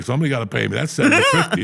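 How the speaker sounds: laughing shout, pitch flying up on the number. Retold or imitated. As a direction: laugh and yell that you want your money. Demanding his pay, retold.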